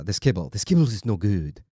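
lightly put-on French accent; half-hearted French accent. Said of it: "why I was using a French accent".

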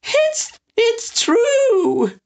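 high wheezing laugh-voice; wheezy old man. Acted. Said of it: "wheezed Boulanger".

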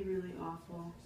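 normal talking voice

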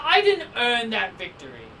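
ordinary talk, nothing marked

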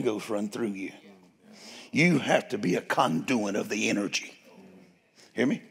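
low, soft and unhurried; gentle fatherly guidance. Real, not acted. Father gently teaches his son Elijah, speaking low and soft.

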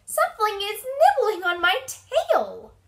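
high, loud mouse complaint; alarmed squeak. A narrator's reading. Mouse feeling a fish at the tail, squealing high. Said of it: "squeaked Mouse".

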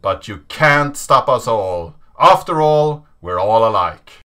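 intense narration over music; dramatic reading. A narrator's reading. Reading the Hacker Manifesto to viewers, intense and dramatic. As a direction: read it like a defiant manifesto.

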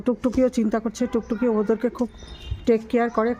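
ordinary talk, nothing marked

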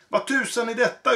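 baffled by his own cannon; exclaiming. Acted. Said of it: "utbrast han".

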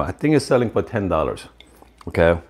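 normal talking voice

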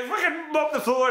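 hammed-up bossy order; mock order. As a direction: bark it like a cranky sensei. Sensei orders him to mop.

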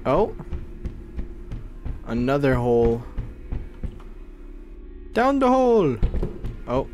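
ordinary talk

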